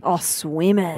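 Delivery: low breathy swoon over swimmers; suggestive. Real, not acted. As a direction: say it with a lustful sigh. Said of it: "The way I said that was weird".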